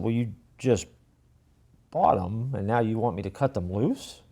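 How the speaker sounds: ordinary delivery, nothing marked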